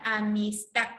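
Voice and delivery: "Amistad" broken up, low, landing on "tad"; "Amistad" in separate syllables, stressed "tad"; stress on final syllable. Shown as a demonstration. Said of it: "la mayor fuerza de voz está en tac".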